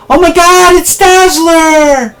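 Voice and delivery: very high, drawn-out excited squeal; starstruck fan squeal. Acted. Bystander spots Dazzler in the street, starstruck squeal. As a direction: squeal like you just spotted your idol.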